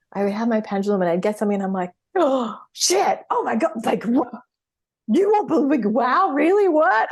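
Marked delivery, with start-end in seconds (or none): 2.1-7.1 shocked. Retold or imitated. Reliving her shock at the pendulum's answer, high astonished exclamations. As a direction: blurt it out like the pendulum just floored you. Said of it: "I was so shocked".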